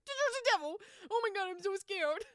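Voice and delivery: high, loud hammed-up shriek of fright; mock terror. Mocking the jumpy hunter, hammed-up scared shriek. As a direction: play the panicked hunter, over the top.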